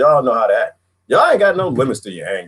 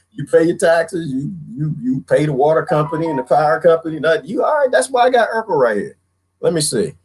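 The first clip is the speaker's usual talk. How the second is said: normal talking voice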